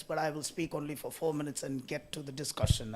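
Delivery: usual speaking voice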